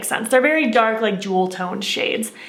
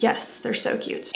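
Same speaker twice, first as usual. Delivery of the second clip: whispering; 8 dB quieter, slower than usual. Real, not acted. Whispered aside about the pencils.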